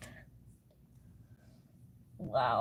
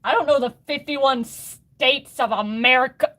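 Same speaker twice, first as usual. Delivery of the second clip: louder, higher, exasperated; flustered outburst; 6 semitones higher, 8 dB louder than usual. Real, not acted. Streamer confessing her geography gaps, flustered and loud. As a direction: throw it out in embarrassed frustration.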